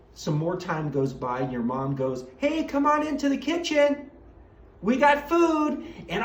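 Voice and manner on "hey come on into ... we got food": calling out. Acted. Mom calls kids to the kitchen.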